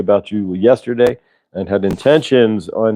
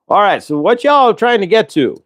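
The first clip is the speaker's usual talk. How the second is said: Southern cop; 9 semitones higher than usual. Acted. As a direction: call out as a mock Southern cop. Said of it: "we're in the South".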